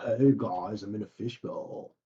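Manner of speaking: ordinary delivery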